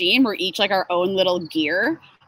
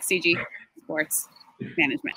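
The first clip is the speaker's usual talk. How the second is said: slow, with a pause after each word; halting, over-careful company name; deliberately slow; 8 dB quieter than usual. Shown as a demonstration. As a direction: spell it out slowly, piece by piece. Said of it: "I say it so slowly now".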